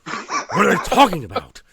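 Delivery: high, loud, laughing confusion; laughing disbelief. Retold or imitated. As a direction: laugh through the bafflement. Retold baffled outburst.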